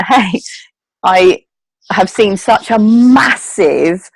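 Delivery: ordinary talk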